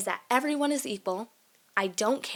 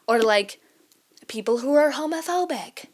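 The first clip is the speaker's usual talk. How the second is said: incredulous; 5 semitones higher, 6 dB louder than usual. Real, not acted. Exasperated at homophobes.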